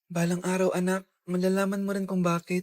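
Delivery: low, soft voiceover; inner voice. Acted. Mother's inner thought about her daughter, low and soft. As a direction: say it softly to yourself.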